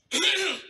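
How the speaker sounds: high throat clear before denying; guilty throat clearing. Acted. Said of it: "you always sound like you're lying".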